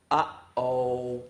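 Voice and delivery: low ominous uh-oh; mock foreboding; dramatic uh-oh. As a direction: drop into a spooky uh-oh. Book makes her say uh-oh.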